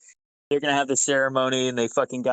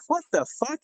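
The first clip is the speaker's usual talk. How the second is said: high-pitched what the fuck; stunned disbelief; 14 semitones higher than usual. Retold or imitated. Told he's acting platoon sergeant, high-pitched disbelief. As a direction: squeak it out in shock.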